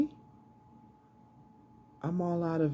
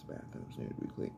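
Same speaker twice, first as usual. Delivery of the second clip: hushed unvoiced aside; whisper; 12 dB quieter, faster than usual. Real, not acted. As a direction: breathe it as a private whisper. Aside about chores.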